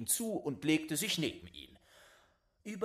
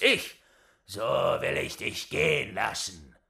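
loom over the little wretch; menacing old giant; 8 dB louder than usual. Acted.

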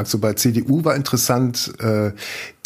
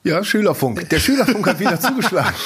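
laughing; 7 semitones higher than usual. Real, not acted. Laughing at partner's announcer voice.

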